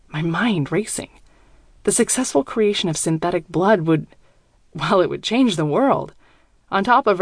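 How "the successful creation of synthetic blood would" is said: ordinary delivery, nothing marked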